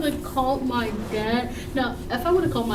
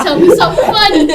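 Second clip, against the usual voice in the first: laughing while quoting; 4 semitones higher, 11 dB louder, faster than usual. Retold or imitated. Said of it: "this guy said".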